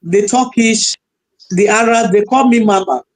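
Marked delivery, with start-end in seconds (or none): none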